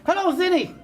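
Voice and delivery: high, loud puppet hello; effusive greeting. Acted. Puppet greeting the host Vinny, effusive. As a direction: hail him with pitch well up.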